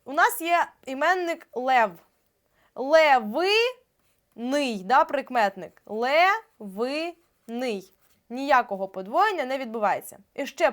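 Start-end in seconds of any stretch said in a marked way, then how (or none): 2.7-4.8 левиний дуже повільно, ле-ви-ний з паузами; розбий «левиний» на окремі розтягнуті склади; по складах. Shown as a demonstration. Вчителька диктує слово по складах. 5.9-7.9 левиний дуже повільно, ле-ви-ний з паузами; розбий «левиний» на окремі розтягнуті склади; по складах. Shown as a demonstration. Повтор слова по складах.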